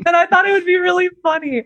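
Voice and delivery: high, quick, squeezed through laughter; laughing while talking. Real, not acted. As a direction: still cracking up at the joke. Cracking up at own impression.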